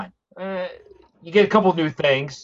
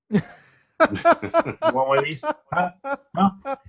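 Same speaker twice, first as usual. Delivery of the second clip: pushy dealer; 9 semitones higher than usual. Retold or imitated.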